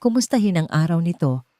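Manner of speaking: ordinary delivery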